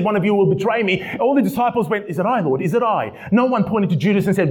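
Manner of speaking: high-pitched, urgent preacher's delivery; impassioned preaching. Real, not acted. Preaching at full force.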